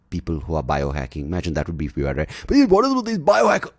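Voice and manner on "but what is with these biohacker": strained by aching joints. Real, not acted. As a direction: voice jumps up as joints ache. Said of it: "yeah, my joints".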